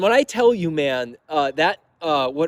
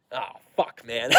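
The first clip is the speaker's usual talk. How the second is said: muttered dread; 5 semitones lower, 8 dB quieter, slower than usual. Retold or imitated. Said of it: "And I'm like".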